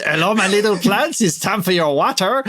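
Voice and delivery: mock French voice for the plants; cooing at plants in a jokey French accent; comedy French accent. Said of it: "in a comedy French accent".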